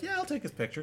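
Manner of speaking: high, chirpy oblivious tourist voice. Pretend. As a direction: squeak it out like a clueless tourist.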